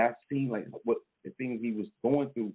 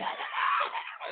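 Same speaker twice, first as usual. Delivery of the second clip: high shrieking laugh. Acted. After the shrieked impression.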